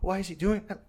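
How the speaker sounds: lightly voiced tripping girl, quiet and uneasy; disturbed girl. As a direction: ask it like something is deeply wrong. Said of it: "she was like".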